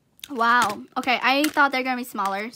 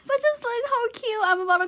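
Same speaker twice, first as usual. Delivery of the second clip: gushing; 10 semitones higher, 3 dB louder than usual. Real, not acted. Gushing over tiny doll.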